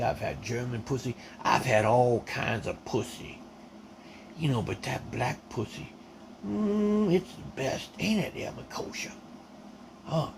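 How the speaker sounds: mock the bully's sneering Kentucky voice; imitating Stanley's taunts. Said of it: "this is the way he talked".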